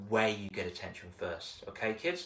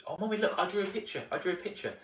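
higher, pleading; lightly voiced little kid; eager child; 6 semitones higher, faster than usual. Child begging mummy to see the picture, higher.

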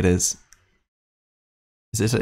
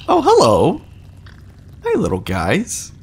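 excited greeting; 8 semitones higher than usual. Real, not acted. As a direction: squeal it at cute creatures. Greeting little creatures.

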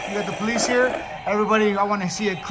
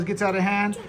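usual speaking voice

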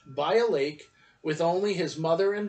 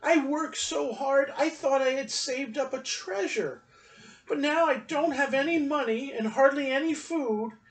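sobbing; 8 semitones higher than usual. A narrator's reading. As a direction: plead it like a crushed little boy on the verge of tears. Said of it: "he sobbed".

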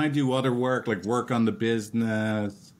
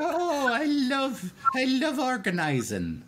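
put-on high, hammy Irish leprechaun accent; squeaky stage-Irish leprechaun lilt, laughing. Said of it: "in a leprechaun accent".

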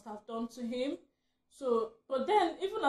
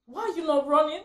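mad; 4 semitones higher, 10 dB louder than usual. Retold or imitated. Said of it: "I was so mad at him".

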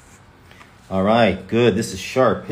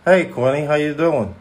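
higher-pitched Long Island friend; Chris Pasalacqua; 5 semitones higher than usual. Acted. Impersonating a friend greeting someone, Chris Pasalacqua voice. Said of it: "He talks like this".

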